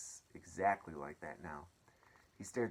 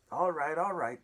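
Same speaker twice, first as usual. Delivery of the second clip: crowd of reporters; 8 semitones higher, 10 dB louder than usual. Acted. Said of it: "they answered".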